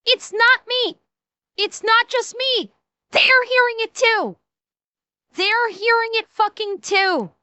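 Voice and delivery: high-pitched, insistent outburst; vindicated exclamation. Real, not acted. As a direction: exclaim it high, as if finally proven right. Vindicated that others hear it too.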